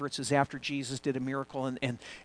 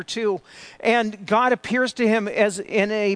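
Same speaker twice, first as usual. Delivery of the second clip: louder, higher preaching that swells as it goes; building sermon; 5 semitones higher, 7 dB louder than usual. Acted. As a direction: preach it, gathering force line by line.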